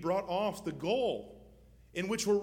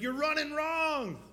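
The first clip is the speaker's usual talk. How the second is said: insistent; 10 semitones higher, 5 dB louder, slower than usual. Retold or imitated. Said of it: "He's like".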